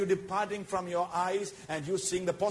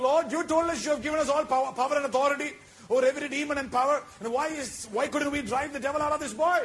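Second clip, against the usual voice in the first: raised pleading question; 6 semitones higher, 4 dB louder than usual. Retold or imitated. Disciples confused, pleading to Lord.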